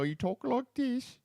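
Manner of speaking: high-pitched mock English accent; hammed-up English accent, pitched high. Shown as a demonstration. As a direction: put on a squeaky English accent. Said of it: "Let me hear your English accent".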